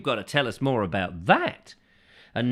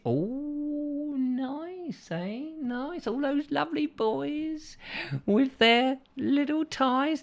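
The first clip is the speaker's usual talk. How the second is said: swooning schoolgirl; 13 semitones higher, much slower than usual. Pretend. Host mocking caller Judith's crush, high swooning voice. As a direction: gush over the boys like a schoolgirl.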